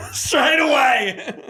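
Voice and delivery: loud drawn-out exclamation. Real, not acted. Laughing exclamation at story.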